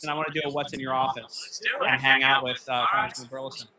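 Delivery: ordinary delivery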